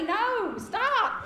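rising, pleading no, stop; high exasperated plea. Real, not acted. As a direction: beg them to stop. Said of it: "pleading against horror stories".

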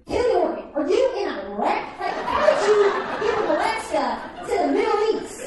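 high, loud motherly panic; imitated freaking-out mom. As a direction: shriek the questions in mock alarm. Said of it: "She is freaking out".

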